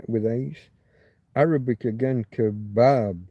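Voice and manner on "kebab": "kebab" as kuh-BAB, falling; stress on second syllable. Shown as a demonstration. Streamer repeating the Arabic word, second-syllable emphasis. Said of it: "You've got to put that emphasis again".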